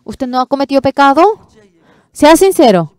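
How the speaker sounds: high, loud demanding questions; a pastor grilling the preacher, high and loud; challenging shout. Retold or imitated. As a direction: press him hard, loud and high.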